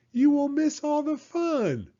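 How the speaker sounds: high, eager frog coaxing; pleading frog. Acted. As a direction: tempt him out of bed. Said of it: "cried Frog".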